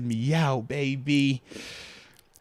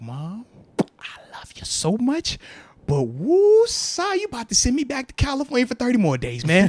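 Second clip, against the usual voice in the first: exasperated son; 6 semitones higher, 5 dB louder than usual. Retold or imitated. Son replying to his mom in mock despair. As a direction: sigh it out, overwhelmed. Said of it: "And I'm like".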